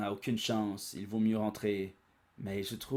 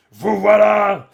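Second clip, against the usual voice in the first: much louder, higher roar; booming confrontation; 10 semitones higher, 20 dB louder, slower than usual. Acted. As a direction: bellow at the intruders. Gyumao confronts them.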